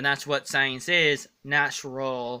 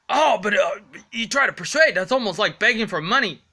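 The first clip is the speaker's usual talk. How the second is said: higher, louder mocking critic voice; whiny critic; 6 semitones higher, 6 dB louder, faster than usual. Vlogger mocking a critic who says he begs for money, higher and louder. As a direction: ham up the nagging critic. Imagined critic arguing with him.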